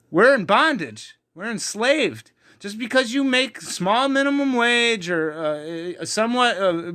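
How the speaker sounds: rising sermon fervour; fiery preaching. Acted. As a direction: preach it out to the congregation. Preaching at full force.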